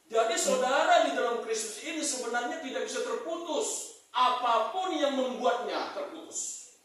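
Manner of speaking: high, loud preaching voice; preaching at full force. Acted. Preacher urging the congregation to forgive, in a high, loud preaching voice. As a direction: drive the point home to the church.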